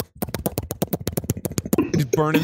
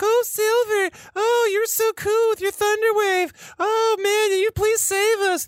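much higher mock-adoring voice, slower at the end; mocking fawning voice; slower than usual. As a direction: mimic the others swooning over you. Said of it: "That's what they're gonna say to me".

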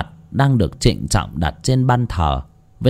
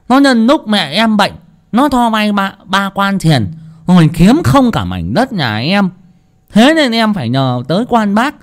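furious cursing; 8 semitones higher than usual. Acted. Wronged boy curses the cruel landlord, furious and high. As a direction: curse the landlord to the whole village. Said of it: "tức chí mà chửi đồng".

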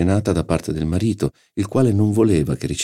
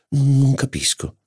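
confiding duchess; 9 semitones higher, slower than usual. A narrator's reading. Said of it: "mi confidò quasi per scusarsi".

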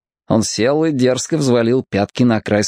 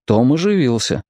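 ordinary delivery

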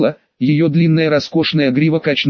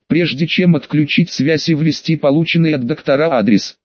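ordinary delivery

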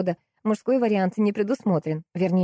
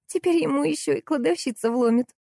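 high-pitched, pleased prediction; gleeful; 9 semitones higher than usual. A narrator's reading. Salesgirl rejoices at her husband's trouble, gleeful. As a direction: relish his coming trouble. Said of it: "обрадовалась девчонка".

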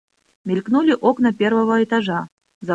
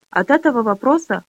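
usual speaking voice

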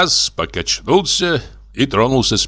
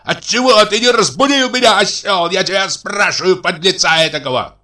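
high-pitched, scolding outburst; angry tirade; 10 semitones higher than usual. Acted. Brother abusing brother at the carriage, angry tirade. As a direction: hurl the insults high and heated. Acted quarrel, brother hurling insults.

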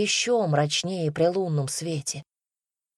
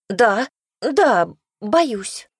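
frightened; 4 semitones higher, 5 dB louder, much slower than usual. A narrator's reading. Frightened maid admits fear.

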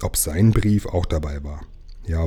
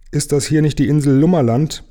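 high, loud inquiry; calling out; 7 semitones higher, 5 dB louder than usual. A narrator's reading. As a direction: call the question across the dark. Stranger calls from ship in dark.

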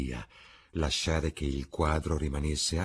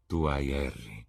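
a touch of sorrow, understated; sadly; 4 semitones lower than usual. A narrator's reading. Painter to Dorian, mildly sad. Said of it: "disse il pittore tristemente".

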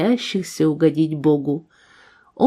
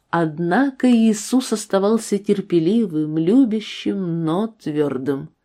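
usual speaking voice

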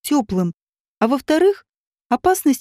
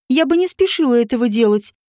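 ordinary delivery, nothing marked

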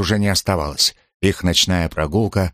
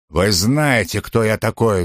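usual speaking voice

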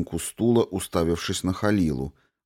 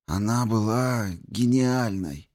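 high, slow, breaking with grief; 4 semitones higher, slower than usual. A narrator's reading. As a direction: grief cracks the voice. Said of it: "Голос Дарио сорвался".

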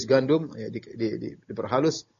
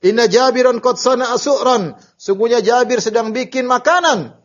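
loud, high-pitched call to a crowd; imitating a rallying shout; retold shout; 9 semitones higher, 6 dB louder than usual. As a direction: call out to all the trench diggers, voice raised high. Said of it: "Nabi teriak".

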